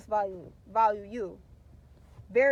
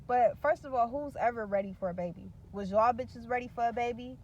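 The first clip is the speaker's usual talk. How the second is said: usual speaking voice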